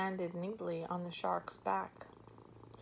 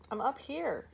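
called back; 7 semitones higher, 3 dB louder than usual. A narrator's reading. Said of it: "she called back".

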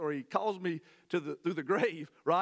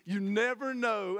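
ordinary talk

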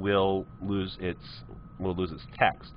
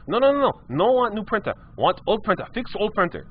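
much higher broken-English customer, insisting; high insistent customer; 9 semitones higher than usual. Retold or imitated. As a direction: squeak out the demands, won't budge. Customer voiced in retold story.

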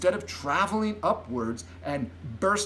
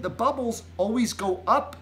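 put-on higher, earnest girl's voice; light, raised-pitch explanation; Sophie explaining; 7 semitones higher than usual. A narrator's reading. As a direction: explain it pointedly as a child. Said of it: "Sophie said".

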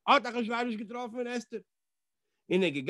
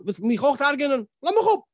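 rising to a high, loud cry; indignant outburst; 5 semitones higher, 5 dB louder than usual. Retold or imitated. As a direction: throw the king off sharply. Esther fährt den König an. (empört, hoch und laut aufbrausend)

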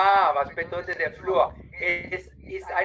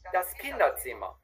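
normal quick speed, falling tune; quick follow-up take; quick natural take; 4 dB quieter than usual. Shown as a demonstration. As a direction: say it as natives would. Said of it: "y la otra vez rapidito".

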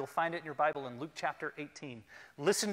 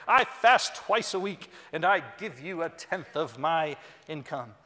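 loud, pompous Pharisee praying to be heard; self-righteous voice; 3 semitones higher, 12 dB louder than usual. Acted. Pharisee praying aloud to show off, loud and self-righteous. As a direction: puff yourself up and boast to God, much louder. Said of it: "a very self-righteous voice".

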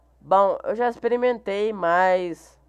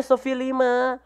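usual speaking voice

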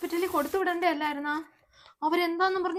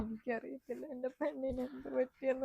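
softer tearful lament; crying; 3 semitones lower, 7 dB quieter than usual. Acted. Mother fears for her daughter, softer and tearful. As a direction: sob out the worry quietly. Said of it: "നീ കരയണ്ട".

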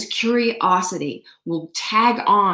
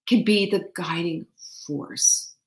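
ordinary talk

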